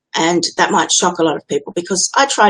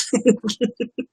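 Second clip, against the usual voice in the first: a quick little laugh; short laugh; 5 semitones higher, 5 dB quieter than usual. Real, not acted. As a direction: laugh briefly. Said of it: "maybe they want to listen to me laugh".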